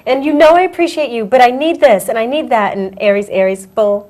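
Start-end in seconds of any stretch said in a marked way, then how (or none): 0.0-2.8 high, loud, insistent mock rant; imitating own frustration. Co-host replaying her upset speech to her friend, mock frustrated. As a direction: rattle off grievances in hammed-up exasperation. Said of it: "when I get upset, I'm like".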